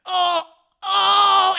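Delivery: high, loud "oh" groans; hammed-up cries of distress; mock moaning. Cornered dwarf begging, acted loud moans. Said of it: "why you making those sounds".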